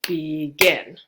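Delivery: "begin" with the weight on -gin; stress on second syllable. Shown as a demonstration. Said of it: "you can see the stress is".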